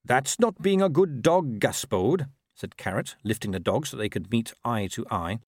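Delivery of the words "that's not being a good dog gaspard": slow, higher, earnest scolding; mild reproach. A narrator's reading. Watchman holding the dog up, slow mild reproach. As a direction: chide the dog patiently.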